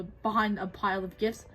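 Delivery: usual speaking voice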